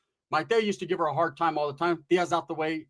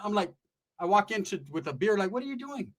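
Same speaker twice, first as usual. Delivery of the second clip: usual speaking voice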